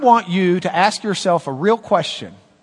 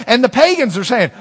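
ordinary talk, nothing marked

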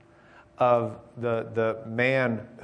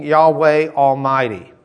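Yahweh Almighty cried out louder and drawn out; wailing cry; 3 semitones higher, 10 dB louder, slower than usual. Retold or imitated. Preacher voicing Isaiah crying out to Yahweh Almighty, louder and slow. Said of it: "I cried".

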